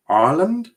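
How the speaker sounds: "Ireland" as a high rising question; pronouncing the R. Shown as a demonstration. Streamer checks his pronunciation with viewers, questioning. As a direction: test the R in "Ireland", asking if it is right. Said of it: "you need to pronounce the R".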